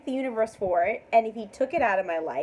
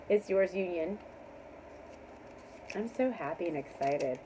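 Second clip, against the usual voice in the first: usual speaking voice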